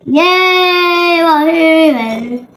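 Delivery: loud, sing-song yay and wahoo; excited cheer. Real, not acted. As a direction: celebrate out loud. Cheering at the result.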